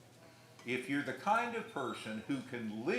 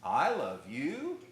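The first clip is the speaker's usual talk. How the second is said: slow, louder, mocking; sarcastic I love you; 3 semitones higher, 7 dB louder, slower than usual. Shown as a demonstration. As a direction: drag it out with scorn. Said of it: "Same words, totally different meaning".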